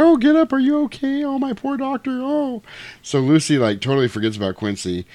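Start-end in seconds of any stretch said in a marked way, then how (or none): 0.0-2.6 very high cooing falsetto; mock fussing girl. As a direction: fuss over him like a hammed-up swooning girl. Lucy fussing over fallen doctor.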